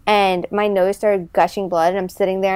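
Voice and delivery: ordinary delivery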